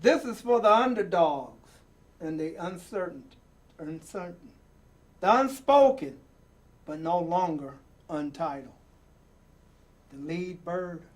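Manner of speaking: rhythmic, building reading with falling endings; dramatic poem reading. Acted. Host reading a picture book poem to young viewers, rousing. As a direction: read the poem like a rallying call. Reads The Undefeated poem aloud.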